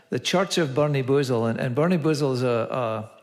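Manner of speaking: ordinary delivery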